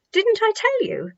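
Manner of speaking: high, pleased told-you-so; triumphant. A narrator's reading. Salome to the group, triumphant. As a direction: gently crow it.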